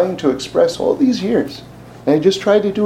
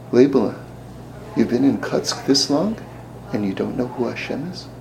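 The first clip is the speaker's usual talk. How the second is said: lower, softer, fading reproach; soft rebuke; 4 semitones lower, 6 dB quieter than usual. Retold or imitated. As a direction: quietly shame him. Men chide softly.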